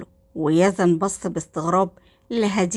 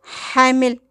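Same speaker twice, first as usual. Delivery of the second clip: higher, stunned one-word question; shocked; 5 semitones higher, 4 dB louder, much slower than usual. A narrator's reading. Hadir hears of the pregnancy, shocked. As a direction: echo it in shock, pitch raised. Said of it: "هدير بصدمة".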